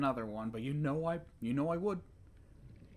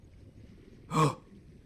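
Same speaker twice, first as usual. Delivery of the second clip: startled gasp; 2 semitones higher, 5 dB louder than usual. Real, not acted. Startled by music beep.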